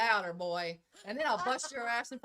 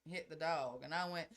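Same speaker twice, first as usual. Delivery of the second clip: ordinary talk, nothing marked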